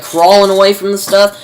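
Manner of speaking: normal talking voice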